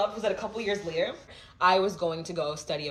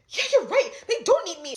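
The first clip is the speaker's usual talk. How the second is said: laughing agreement; 12 semitones higher, 5 dB louder than usual. Retold or imitated. Mock agreement with church leader.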